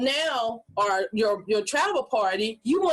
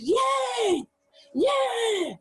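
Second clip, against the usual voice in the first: cheering crowd; 12 semitones higher than usual. Retold or imitated. Crowd cheering at the arena entrance, shouting. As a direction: yell like fans as the lights come up. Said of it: "and everybody".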